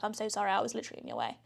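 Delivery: fast, soft British apology; rushed apology. Retold or imitated. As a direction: blurt the sorry out quickly and quietly. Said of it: "incredibly apologetic".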